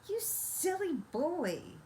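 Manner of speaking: high, slow, exasperated scolding; cross mother. Acted. Mother scolding her son for ruining the meat, high and slow. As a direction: scold him, drawn out and high. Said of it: "stamping her foot crossly".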